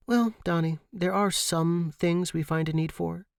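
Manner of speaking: playful chiding, knowing smile; teasing. A narrator's reading. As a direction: tease him gently. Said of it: "she chided with a knowing smile".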